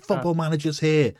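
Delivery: put-on presenter line; mock announcement. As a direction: announce it like a title on a thumbnail. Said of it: "Football Manager case and say".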